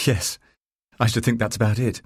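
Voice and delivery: plain reading voice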